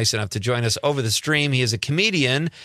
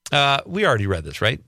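usual speaking voice

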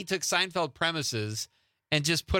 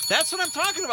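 excited cheer; 4 dB louder, faster than usual. Real, not acted. Host cheers right answer.

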